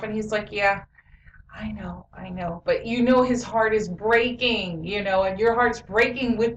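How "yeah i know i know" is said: imitating a brave child, hushed; soft, near-whispered putting up a front; trying to be tough. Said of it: "trying to be tough".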